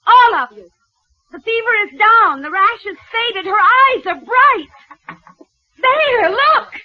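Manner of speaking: high, loud calls to the crowd; proclaiming loudly. Acted. Doctor shows the healed baby to the mob, calling out loud. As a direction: hold up the proof and call it out.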